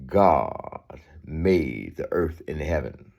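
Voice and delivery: ordinary talk, nothing marked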